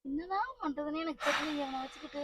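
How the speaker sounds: very soft resigned mutter; helpless despair. Acted. Mother at a loss with her drunk son, quietly despairing. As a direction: grumble hopelessly to yourself.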